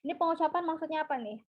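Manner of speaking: ordinary talk, nothing marked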